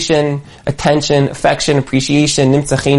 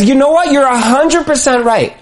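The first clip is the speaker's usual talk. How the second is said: much higher, eager full agreement; emphatic agreement; 9 semitones higher than usual. Retold or imitated. Warlord to negotiator, hammed-up hearty agreement. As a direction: grant it wholeheartedly. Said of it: "And he says".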